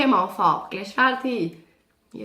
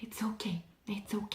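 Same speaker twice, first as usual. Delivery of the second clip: much softer, hushed it's ok; guide's soft reassurance; 2 semitones lower, 18 dB quieter than usual. Retold or imitated. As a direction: soothe her quietly, over and over. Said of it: "Le guide me disait tout le temps genre".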